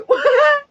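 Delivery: sharp high burst of laughter. Real, not acted. Vlogger laughing at her son's joke, high and loud.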